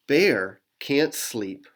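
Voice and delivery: ordinary delivery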